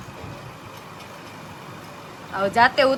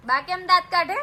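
ordinary delivery

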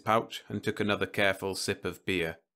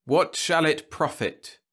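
title read; 8 semitones higher, 6 dB louder, slower than usual. A narrator's reading. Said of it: "story title read".

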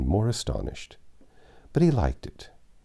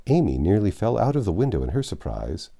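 ordinary delivery, nothing marked

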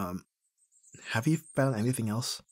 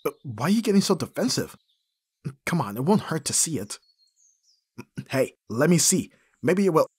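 loud, higher teasing push to see; playful prying; 6 semitones higher, 6 dB louder than usual. Acted. Teasing a friend to show the embarrassing photo, loud and playful. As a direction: needle the listener, bright and insistent.

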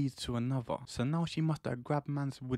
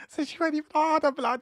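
exasperated; 12 semitones higher, 5 dB louder, faster than usual. Acted. Climax of painful story.

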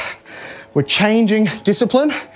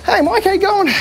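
cheerful greeting; 12 semitones higher than usual. Real, not acted. Greeting a viewer on stream.

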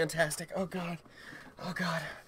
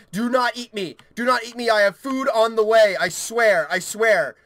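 urgent pleading; 6 semitones higher, 9 dB louder than usual. Real, not acted. Pleading with game creature.

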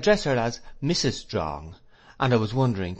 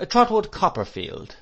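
high, louder formal introduction; schoolmaster's raised announcing voice; announcing a name; 12 semitones higher, 5 dB louder than usual. A narrator's reading. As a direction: present the name to the whole room. Doctor announces pupil to class.

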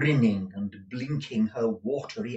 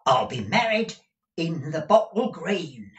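put-on high, loud old master's cry; 8 semitones higher, 7 dB louder than usual. A narrator's reading. Gride telling deaf Peg his choice, crying out. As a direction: shout the news to the deaf. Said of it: "cried Arthur Gride".

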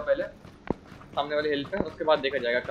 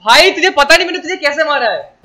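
high, loud gloating yells; excited shouting; 12 semitones higher, 14 dB louder than usual. Real, not acted. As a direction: crow over the kill, loud and high. Gloating after killing a friend.

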